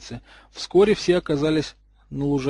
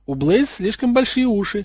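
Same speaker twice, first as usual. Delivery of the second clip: snide, higher-pitched jab; 7 semitones higher than usual. A narrator's reading. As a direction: needle someone with a sarcastic lilt. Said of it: "язвительно сказал Драко".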